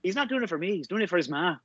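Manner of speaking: high, soft Irish traveller voice; Irish brogue. Retold or imitated. Still doing Brad Pitt's boxer for the co-host, soft high brogue. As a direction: keep the Snatch boxer's accent going. Continues Mickey impression.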